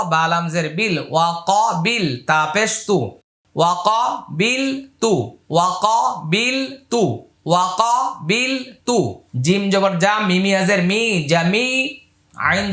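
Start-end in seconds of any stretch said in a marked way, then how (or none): 3.5-9.2 ওয়াকাবিলতু read slow and even; slow model reading. Shown as a demonstration. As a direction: model each syllable slowly. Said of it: "teacher models word slowly".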